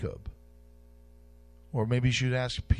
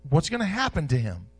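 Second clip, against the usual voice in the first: ordinary talk, nothing marked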